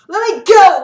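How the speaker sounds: very loud high scream. A narrator's reading. Sarah screaming at the tribune to let her go. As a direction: scream to break free. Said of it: "she screamed".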